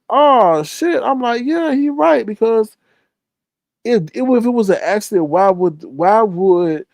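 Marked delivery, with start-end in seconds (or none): 0.1-2.3 high-pitched surprised oh shit; surprised shopper realizing the customer had a point; surprised realization. Retold or imitated. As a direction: squeak out the sudden realization, much higher. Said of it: "And I'm like".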